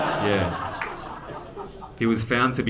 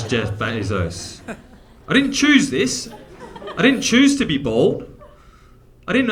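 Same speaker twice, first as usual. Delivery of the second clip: indignant rant; 5 semitones higher than usual. Retold or imitated. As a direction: protest like you're fed up and wronged. Indignant retort, acted barber scene.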